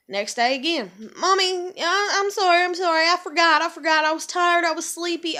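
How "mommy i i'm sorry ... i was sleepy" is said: put-on higher, whiny kid voice; imitating excuse-making child. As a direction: whine out excuses like a sleepy kid. Voicing daughter making excuses.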